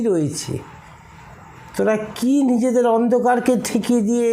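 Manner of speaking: impassioned sermon rebuke; fervent scolding. Acted. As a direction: rebuke them with rising passion. Preacher scolding devotees again.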